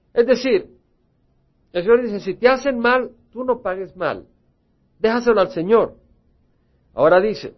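loud, ringing pulpit voice; full-force preaching. Acted. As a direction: preach it out to the whole church. Preacher expounds scripture forcefully.

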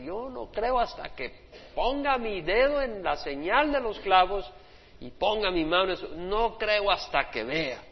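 a skeptical disciple insisting on proof; doubting Thomas. Acted. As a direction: drag out the refusal to believe.

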